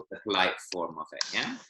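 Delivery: ordinary talk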